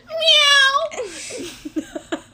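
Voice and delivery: high, loud squeaky meow. Retold or imitated. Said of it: "He goes".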